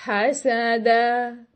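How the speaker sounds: very slow "हसदा" with vowels held far too long; overlong fatha. Shown as a demonstration. Teacher mimicking a dragged-out reading. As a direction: drag out "हसदा". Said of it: "this is not acceptable".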